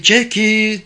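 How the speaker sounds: high, drawn-out call. A narrator's reading. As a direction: call a name out into the distance. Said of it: "diye seslendi".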